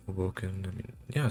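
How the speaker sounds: ordinary talk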